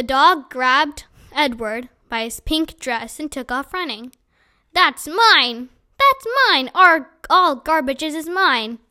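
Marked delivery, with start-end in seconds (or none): 4.7-5.7 loud yell of ownership; shouting mine at the dog; shouted claim. Acted. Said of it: "Ernest shouted". 6.0-6.7 much higher, loud yell of ownership; higher, louder repeat of the shout; shrill shout. Acted. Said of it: "Ernest shouted". 6.7-8.8 loud, slow shout; yelling his claim to the garbage. Acted. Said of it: "Ernest shouted".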